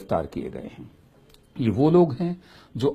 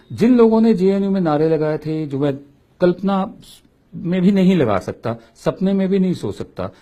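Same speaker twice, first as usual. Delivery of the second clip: raised, louder indignant rant; indignant outburst; 7 semitones higher, 5 dB louder than usual. Real, not acted. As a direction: push it out higher and louder, outraged. Indignant outburst about slogans. (ऊँची, तेज़ आवाज़ में आक्रोश)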